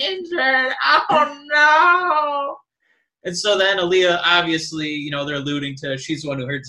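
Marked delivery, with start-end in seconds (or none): 0.0-2.6 high, loud, drawn-out fake weeping; mock sobbing. Host mocking wrestler weeping over injury, hammed-up sobbing. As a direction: wail it out as an exaggerated sob. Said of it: "like sobbing, weeping".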